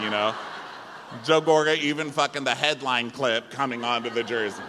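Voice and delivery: usual speaking voice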